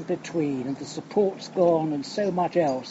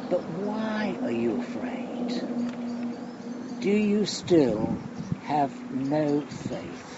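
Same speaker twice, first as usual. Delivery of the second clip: slow searching question; slower than usual. Acted. Preacher pressing Jesus' question on the congregation, slow and searching. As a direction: let each word sink in, very slowly.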